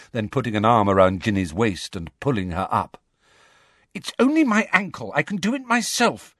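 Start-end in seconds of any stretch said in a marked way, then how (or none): 3.9-6.4 high, irritated insistence; impatient. A narrator's reading. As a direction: brush off help in a higher voice. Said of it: "said Ginny impatiently".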